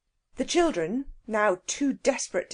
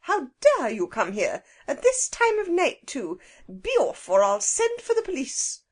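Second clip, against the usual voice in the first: angry; 5 semitones higher, 4 dB louder than usual. A narrator's reading. Angry woman ordering children out.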